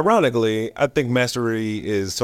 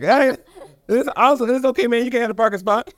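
much higher, laughing nervously as he backs down; nervous placating; faster than usual. Retold or imitated. Backing down from a street fight, nervous high laughing plea. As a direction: give up the parking spot to the scary guy in a high, laughing placating voice. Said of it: "I was like".

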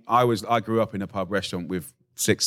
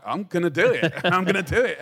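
excited; 10 semitones higher than usual. Retold or imitated. Defiant vow to marinate anyway.

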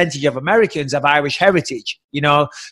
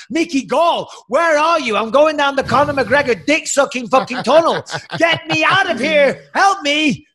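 higher, hammed-up cry to a friend; mock call for help; 9 semitones higher than usual. Begging friend Mickey Gall to save him, hammed-up yell. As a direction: call out for rescue, hamming it up higher.

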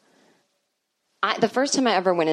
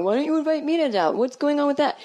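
much higher mock-whiny friends; left-out friends texting, voiced high and whiny; 9 semitones higher than usual. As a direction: imitate friends complaining they weren't invited. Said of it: "fifty texts from people going".

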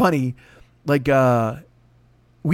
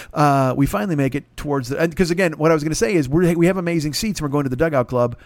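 normal talking voice

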